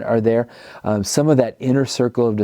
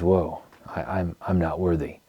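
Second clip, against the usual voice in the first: softer, lower, halting quote of an awed man; humbled awe; 4 semitones lower, 7 dB quieter than usual. Retold or imitated. Fisherman to Jesus after the miraculous catch, quietly humbled. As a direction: say it low and haltingly, overwhelmed.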